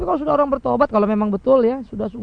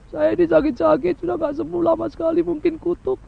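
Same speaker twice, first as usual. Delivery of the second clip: higher, plaintive sick churchgoer; complaining believer pleading with the pastor; whining congregant; 5 semitones higher than usual. Retold or imitated. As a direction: moan about the sickness, suspecting a curse. Congregant blames illness on curse.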